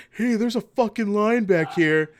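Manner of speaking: loud, high, slow call-out; mock shout of protest, drawn out; imitated heckler's yell. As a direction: yell it like an annoyed guy back in a queue. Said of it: "have a guy behind us go".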